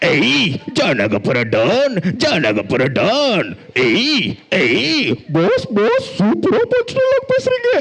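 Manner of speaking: slow, drawn-out villain swagger; milk each word like a cartoon don; swaggering movie one liner. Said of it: "ஒரு villain character வரும்".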